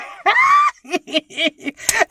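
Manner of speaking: shrieking burst of laughter; loud high laugh. Real, not acted. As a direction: crack up loudly at yourself. Laughing at own outburst.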